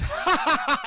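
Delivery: high loud mock villain laugh; evil laugh. Paralyzed Zero laughing at Logan, mock evil laugh. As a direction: cackle like a cartoon villain. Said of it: "He just does an evil laugh".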